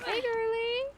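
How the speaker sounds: high, sing-out call; high greeting. Real, not acted. Player calling another player over, high greeting. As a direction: call out high to a gaming buddy.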